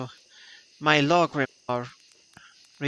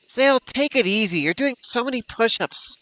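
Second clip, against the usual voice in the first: high teasing voice; 8 semitones higher, faster than usual. Acted. Friend calling out to Zale.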